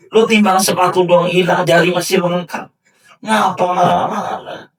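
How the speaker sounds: high, loud, laughing rebuke; mocking a fuss over lost shoes, laughing; laughing scolding. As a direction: tease him while laughing. Legless man laughs at angry man.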